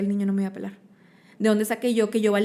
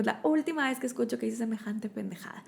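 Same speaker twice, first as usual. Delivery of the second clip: stern whisper; 3 semitones higher, 6 dB quieter than usual. Retold or imitated. Mom scolds crying teenage daughter.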